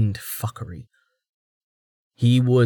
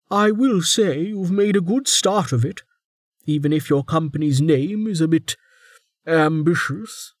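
higher, dry praise; warden voice; 7 semitones higher than usual. A narrator's reading. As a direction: compliment with a dry twist.

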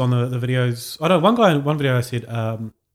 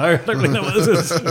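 laughing through quote; 5 semitones higher, 4 dB louder than usual. Retold or imitated. Retelling caller's clueless answer.

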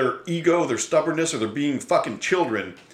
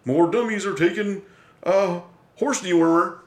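snarky mockery; 6 semitones higher, 4 dB louder than usual. Mimicking cable news mocking ivermectin takers, snarky and higher. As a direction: sneer it like a smug news anchor. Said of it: "their snarky little".

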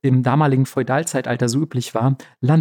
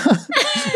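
squeaky giggle; a high giggling burst; high burst of laughter. Real, not acted. Laughs at the absurd birth.

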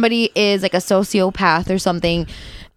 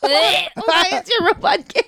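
laughing; 9 semitones higher, slower than usual. Real, not acted. Laughing question.

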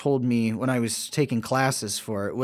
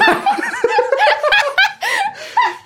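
breaking into laughter; 6 dB louder than usual. Real, not acted. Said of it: "This is what happens when I have to improv".

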